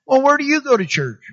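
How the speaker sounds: new believer asking where you go, lightly voiced; curious question. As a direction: play the eager new friend asking.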